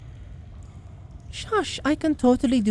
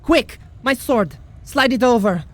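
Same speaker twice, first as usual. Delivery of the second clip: high, loud cry for the sword; urgent shout; 4 semitones higher, 3 dB louder than usual. Acted. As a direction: shout it while grappling the monster. Urgent call in fight.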